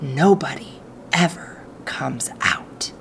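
hushed, soft whisper; secret whisper. Acted. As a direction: lean close and whisper the secret. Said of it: "lowered his voice to a soft, secret whisper".

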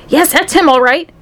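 high, eager exclamation; lightly acted thrill; excited yell. A narrator's reading. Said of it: "yelled Mister Wonka".

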